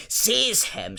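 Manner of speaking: high, loud shriek; Voldemort shrieking at his servant. A narrator's reading. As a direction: shriek the order. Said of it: "shrieked Voldemort again".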